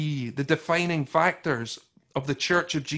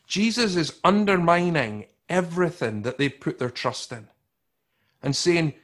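usual speaking voice

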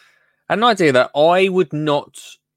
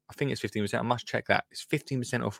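muttered aside; 11 dB quieter, faster than usual. Real, not acted. Aside to self.

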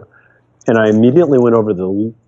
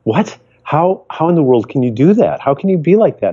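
higher-pitched voice of baffled people; mock incredulity; 6 semitones higher than usual. People questioning the upbeat speaker after hard times, incredulous. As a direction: quote others asking in disbelief. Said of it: "people would say to me".

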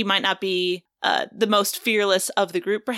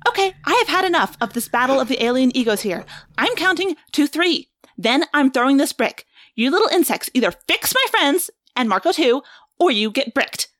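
higher, a little louder, fed up; Rachel's threat; 6 semitones higher, 4 dB louder than usual. Acted. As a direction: count them down like a furious warrior. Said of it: "in character voice".

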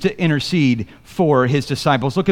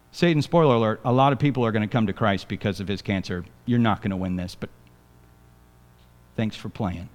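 much softer, quick smug taunt to Satan; taunting aside; 8 semitones lower, 9 dB quieter, faster than usual. Real, not acted. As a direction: rub it in quietly, a little faster.

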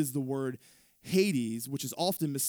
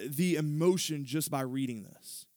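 ordinary talk, nothing marked

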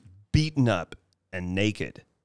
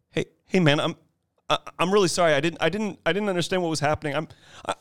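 apologetic jock; 8 semitones higher, much faster than usual. Retold or imitated. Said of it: "the jock goes".

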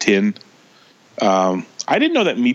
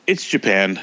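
normal talking voice

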